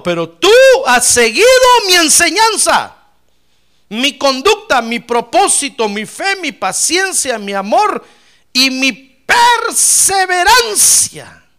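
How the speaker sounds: loud, high preacher's proclamation; proclaiming scripture. Acted. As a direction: build to a drawn-out, ringing final word. (proclamando la Escritura con fuerza)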